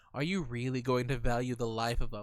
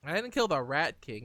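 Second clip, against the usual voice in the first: ordinary delivery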